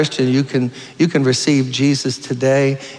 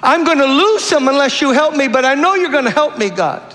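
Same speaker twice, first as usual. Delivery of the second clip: much higher, tearful pleading mother; weeping mother's prayer; 11 semitones higher than usual. Retold or imitated. Preacher imitating the mother weeping over her son, high and pleading. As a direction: cry out to God for your son. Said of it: "She wouldn't let go".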